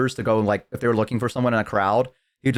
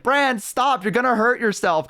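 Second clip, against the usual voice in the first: much higher, worried plea from the branch; high pleading friend; 11 semitones higher than usual. Retold or imitated. Tearful tiefling begging her friend to stop climbing, high pleading. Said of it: "And she's like".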